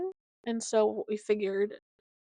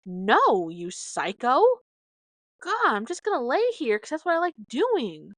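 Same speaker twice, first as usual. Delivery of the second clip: alarmed puppy; 4 semitones higher, 6 dB louder than usual. Retold or imitated. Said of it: "and he's like".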